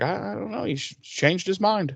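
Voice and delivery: a coy little shrug in the voice; mock innocent. Giles brushing off the gang, softly mock innocent.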